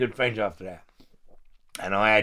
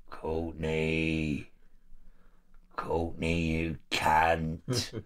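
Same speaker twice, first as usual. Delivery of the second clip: menacing growl; 7 semitones lower, 4 dB quieter, much slower than usual. Retold or imitated. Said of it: "The voice was out of a fucking horror film".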